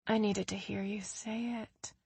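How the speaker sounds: almost whispered, close to the phone; soft, hushed admission of feeling; barely above a whisper. A narrator's reading. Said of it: "barely above a whisper".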